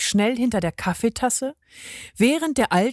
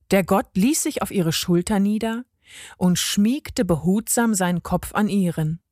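plain reading voice

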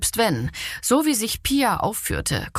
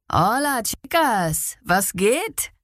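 cocky street punk; 3 semitones higher, slower than usual. A narrator's reading. Street junkie accosts two women.

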